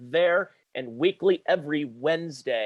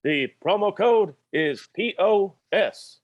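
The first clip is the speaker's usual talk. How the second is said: slow, halting Obama cadence, higher pitched; Barack Obama; 5 semitones higher, 4 dB louder, slower than usual. Acted. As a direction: stretch it out like Obama, word by word. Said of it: "do the promo code as Barack Obama".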